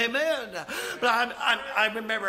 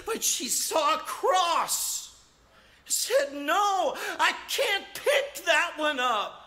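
preaching emphasis; 8 semitones higher, slower than usual. Real, not acted. Preaching at full force.

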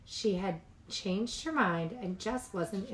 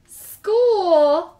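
high, loud groaning "School"; dismayed gasp; 11 semitones higher, 16 dB louder than usual. Acted. Cubs dreading school, loud high wail.

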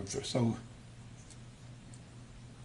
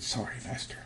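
meek whisper; 7 dB quieter than usual. Acted. The wind answering Yeshua.

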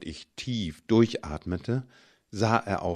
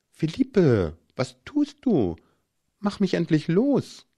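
higher, rising alarm, pleading; alarmed plea; 9 semitones higher, 4 dB louder than usual. A narrator's reading. Bound man begging his boyfriend to untie him, alarmed. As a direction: voice climbing in unease as he's ignored.

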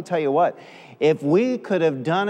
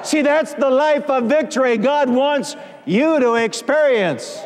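high, ringing declarations with stretched words; full-force preaching; 4 semitones higher than usual. Real, not acted. Preacher declaring victory to congregation, preaching at full force. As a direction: build to triumphant shouted proclamation.

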